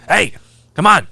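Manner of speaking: mock coach's nudge; imitated coach prodding. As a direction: call out sharply to get them moving.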